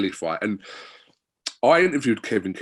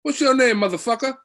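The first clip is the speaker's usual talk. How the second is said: put-on much higher and louder American boxer accent; big brash New York American accent, hammed up; Kevin Kelly's accent; 7 dB louder than usual. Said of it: "I think it's only right that we get the accent".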